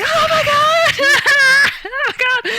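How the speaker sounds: squealing laughing shout of disbelief; excited scream. Retold or imitated. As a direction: scream it out once the call ends. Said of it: "I was playing it really cool".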